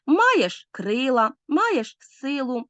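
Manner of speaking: МАЄШ КРИЛА, МАЄШ СИЛУ slowed, first syllable of each word stretched; slow trochaic scansion of "маєш крила, маєш силу"; trochee stress. Shown as a demonstration. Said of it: "Хорей наголошується перший склад, наприклад".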